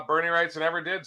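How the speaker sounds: usual speaking voice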